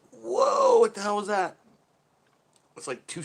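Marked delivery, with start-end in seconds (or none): 0.1-1.6 loud, high cry of shock; startled whoa. Acted. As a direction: blurt it out, stunned by the sneeze. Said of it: "and you're like".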